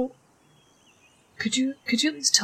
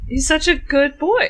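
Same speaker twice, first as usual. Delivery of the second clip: pet talk; 6 semitones higher, 6 dB louder than usual. Acted. Praising the dog.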